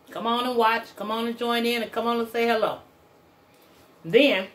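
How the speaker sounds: muffled by hand near lips; talking between bites; hand at mouth while eating. Real, not acted. Seen 2: Hand holds food at her lips through all labelled words.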